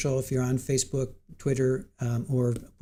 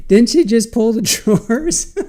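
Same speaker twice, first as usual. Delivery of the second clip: laughing; 8 semitones higher, 9 dB louder than usual. Real, not acted. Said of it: "I'm laughing so hard".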